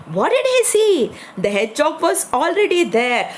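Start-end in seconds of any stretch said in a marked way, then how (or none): 0.1-1.1 put-on hook the children's attention; suspenseful question. Teacher builds suspense to children.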